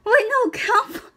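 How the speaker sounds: high, loud call across the room. Real, not acted. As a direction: call out to someone far away. Calling to friend in corner.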